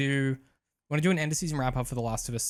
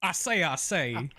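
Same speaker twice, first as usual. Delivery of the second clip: slow, higher Foghorn-style drawl; slow mock Southern rooster; 4 semitones higher, slower than usual. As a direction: stretch it out like a blustering rooster. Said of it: "that lawyer chicken from Futurama".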